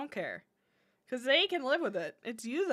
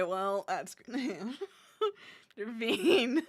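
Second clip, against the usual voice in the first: ordinary talk, nothing marked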